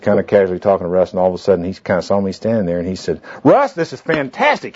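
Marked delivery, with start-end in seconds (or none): 3.4-4.8 high, exclaiming mock excitement; big show of enthusiasm. Man showing off to a colleague for a bystander, hammed-up excitement. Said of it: "he put on a big show".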